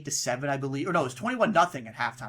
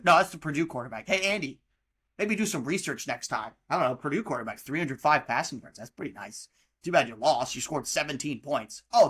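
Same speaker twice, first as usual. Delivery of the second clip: normal talking voice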